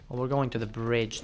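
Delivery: usual speaking voice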